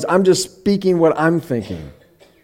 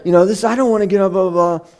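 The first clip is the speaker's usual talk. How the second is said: quick, higher-pitched mock whine; exaggerated child's grumbling; 6 semitones higher, faster than usual. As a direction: rattle off a kid's complaint, hammed up. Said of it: "She's not being this harsh".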